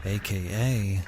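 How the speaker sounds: hushed documentary voice opening a murder story; dramatic podcast intro. Acted. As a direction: read it like a true-crime trailer. Dramatic true-crime intro read.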